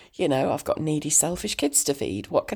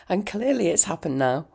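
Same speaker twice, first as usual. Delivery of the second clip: ordinary delivery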